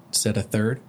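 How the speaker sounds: plain reading voice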